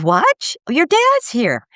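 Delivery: high disbelieving what; retold stunned reply, pitched high; startled disbelief. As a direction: jump up in shock at the news. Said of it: "And I said".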